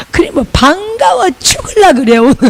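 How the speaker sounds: gleeful high excitement; overjoyed. Retold or imitated. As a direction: squeal how glad you were to meet them. Delighted at meeting fellow pastor.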